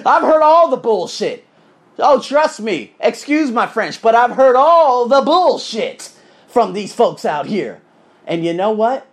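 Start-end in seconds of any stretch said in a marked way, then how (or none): 0.1-7.8 loud exasperation. Real, not acted.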